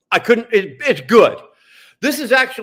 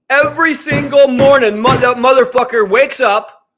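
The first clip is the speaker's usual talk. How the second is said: loud, higher, furious outburst; angry shout; 5 semitones higher, 5 dB louder than usual. Real, not acted. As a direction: shout it out in full rage. Furious rant at Trudeau.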